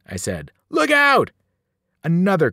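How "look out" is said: high, loud cry of alarm; shouted warning. A narrator's reading. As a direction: shout to warn a friend of danger. Urgent warning to friend in battle.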